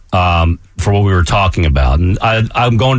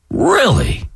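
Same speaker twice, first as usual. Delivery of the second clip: high, drawn-out incredulous "really"; stunned disbelief; 13 semitones higher than usual. Real, not acted. Co-host reacting to breaking news about Robert Kraft, stunned. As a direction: start very high and fall in disbelief.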